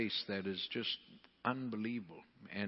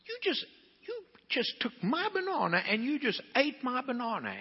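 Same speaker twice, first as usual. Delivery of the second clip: much higher, hammed-up mock outrage; mock indignation. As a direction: play the offended wife for laughs. Scolding husband in retold scene.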